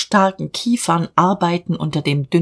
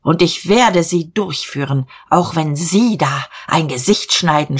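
ordinary delivery

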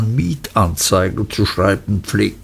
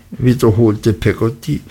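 ordinary delivery

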